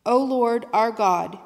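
usual speaking voice

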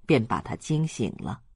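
ordinary delivery

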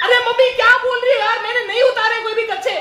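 loud, high exasperated shout; acted outraged son shouting at mom; indignant denial. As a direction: yell back in embarrassed protest. Embarrassed son denies mother's accusation.

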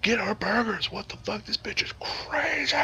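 high panicked mock cries; high-pitched mock diner panic; crowd panic. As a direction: shriek like a frightened diner.

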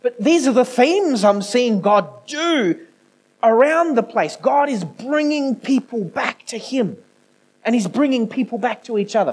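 high-pitched, emphatic preaching; impassioned preaching. Real, not acted. Preacher telling church God is bringing families back, impassioned. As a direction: preach it out with conviction.

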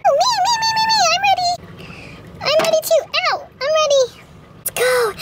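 put-on high, eager kid voices; excited squealing. Girls eager to swim.

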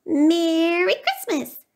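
high, drawn-out little mouse cry; cheerful cry. A narrator's reading. Mouse greets Bear at the door, high excited cry. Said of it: "cried Mouse".

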